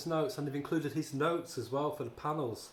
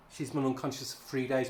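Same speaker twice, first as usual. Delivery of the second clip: normal talking voice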